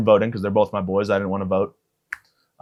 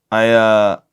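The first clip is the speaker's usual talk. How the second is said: ordinary talk, nothing marked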